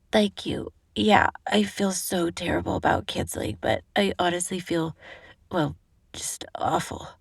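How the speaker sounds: fading to a husky whisper; sick and weak. Acted. Sick Eve answering Lou's call, weak and fading. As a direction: sound ill and drained.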